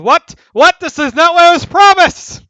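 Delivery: high, very loud indignant yelling; outraged scream. Acted. Architect screaming down about a broken promise, outraged. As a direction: scream down at them in disbelief. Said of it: "the architect's gonna scream down".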